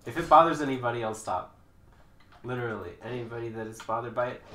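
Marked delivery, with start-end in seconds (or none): none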